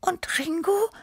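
very high, whispery cat voice; asking. A narrator's reading. Tippi the cat wondering about forgotten Ringo, whispered question. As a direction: ask in a tiny high whisper. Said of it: "fragte Tippi".

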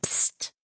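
sharp voiceless hush; urgent "psst" to silence someone; quick warning hiss; whispered shush. A narrator's reading. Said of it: "flüsterte er laut".

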